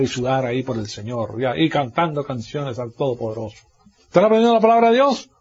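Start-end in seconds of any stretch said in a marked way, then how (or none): 4.0-5.3 rhetorical challenge. Acted.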